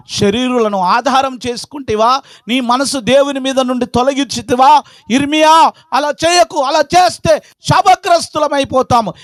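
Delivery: high-pitched pulpit warning, rising; impassioned preaching. Acted. Preacher warning Jeremiah against trusting in men, impassioned. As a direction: build the warning to a high cry.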